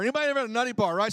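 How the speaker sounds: usual speaking voice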